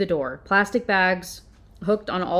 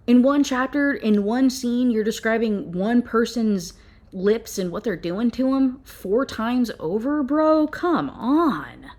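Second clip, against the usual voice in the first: higher, louder, incredulous complaining; rising disbelief, ending on a high bro; exasperated rant; 5 semitones higher, 3 dB louder, slower than usual. Real, not acted. Reviewer mocking the author's lip obsession, exasperated. Ranting about repeated lips.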